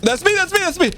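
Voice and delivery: high-pitched rapid-fire plea; alarmed warning. Real, not acted. Player being shot by teammate, alarmed high plea. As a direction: stop friendly fire in a panic.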